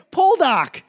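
high, loud Boston "Poldark" with a dropped r; Boston accent. Retold or imitated. Answering a friend, in a Boston accent. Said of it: "that Boston accent shows up".

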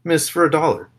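accosting strangers. Pretend. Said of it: "go Billy on the street".

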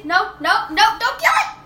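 pleading shout. Real, not acted. Pleading for the ant.

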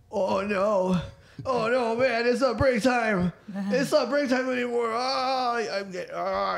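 high, panicked snail voice; the snail freaks out, voice jumping way up; panicking snail. Acted. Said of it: "and he goes".

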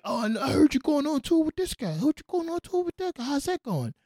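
mock a nosy friend fishing for news; imitating an ex-friend asking about the tour. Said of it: "I just do voices".

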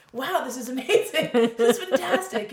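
loud, high burst of delight; retold elation. Meditator feeling the joy, gushing mock elation. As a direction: gush in exaggerated amazement.

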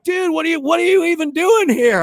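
high, incredulous buddy voice; imitated astonishment. Buddy Frank finding him there, astonished. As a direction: mimic a friend caught off guard.

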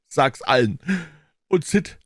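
high, feeble deathbed pleading; hammed-up dying boy; groaning.

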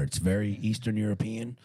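ordinary delivery

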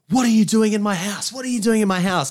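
high-pitched mock scream of alarm; retold scream. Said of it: "who's screaming".

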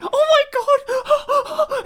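very high, loud, slow overacted scream; mock terrified shriek. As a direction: stretch the cry out in horror-movie panic. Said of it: "And she's afraid".

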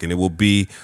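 normal talking voice

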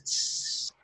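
a soft unvoiced hiss like a snake. Snake hissing in told story.

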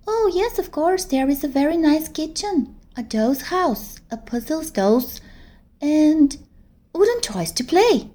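put-on a little girl envying her big friend; small girl voice. Teddy's voice in the story.

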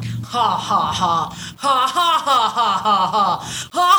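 mock evil cackle running down a tunnel; worst villain laugh. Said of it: "doing the worst villain laugh I've ever heard".

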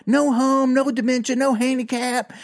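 high-pitched, frantic protest; worked-up mock yelling; retold yelling. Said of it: "I'm kinda yelling at him".

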